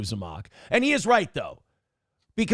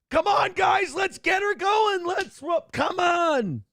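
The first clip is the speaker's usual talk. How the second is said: pitched way up, shouting to the chat; hyped-up rally shout; 13 semitones higher, slower than usual. Shown as a demonstration. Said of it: "That's the difference".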